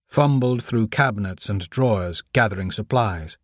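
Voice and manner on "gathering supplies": ordinary delivery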